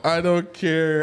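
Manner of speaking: loud, high-pitched wail of sympathy; mock crying. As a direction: wail it out, pretending to break down. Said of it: "bro, I'm crying".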